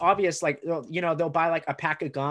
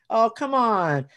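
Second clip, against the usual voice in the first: higher, louder coaxing; coaxing guy; 6 semitones higher, 5 dB louder, slower than usual. Retold or imitated. Guy at the show urging him on, high and loud. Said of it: "the guy goes".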